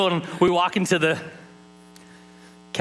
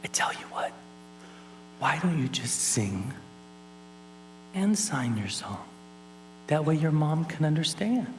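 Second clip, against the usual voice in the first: soothing teacher; 5 semitones higher, 4 dB quieter than usual. Retold or imitated. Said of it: "she goes".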